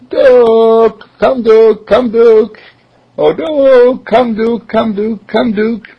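high, loud calling out; calling the dog. Acted. Philip calling for his lost dog, high and loud. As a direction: call him home across the street.

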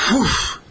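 short imitated gust; blowing whoosh. Rabbit blows on the butterfly, sudden whoosh. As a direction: make a puff of blowing air.

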